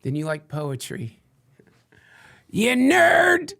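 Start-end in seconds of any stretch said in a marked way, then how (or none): 2.5-3.5 high, loud teasing yell; mock insult shout. Host teases poetry-loving listeners with high loud yell. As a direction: jab at them like a playground taunt.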